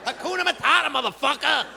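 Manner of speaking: high, rising New York street holler; street guy yelling. Retold or imitated. Truck unloader hollering at his coworker, high rising street holler. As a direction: call it out to your buddy across the truck.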